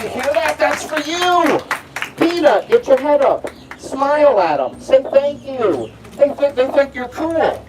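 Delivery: high, excited animal-trainer call; playful praise. Pretend. Trainer telling alligators the applause is for them, excited praise.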